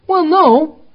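high, loud put-on answer; mock believer's admission. Mimics believer's sheepish admission.

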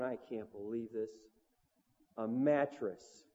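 low, soft disbelief, mattress drawn out slowly; quietly can't believe it; stunned mutter. Retold or imitated. Bandleader alone with a mattress, soft stunned disbelief. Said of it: "And I'm going".

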